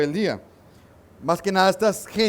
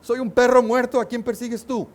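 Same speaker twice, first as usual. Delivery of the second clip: confronting; 7 semitones higher than usual. Retold or imitated. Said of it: "lo confronta y le dice".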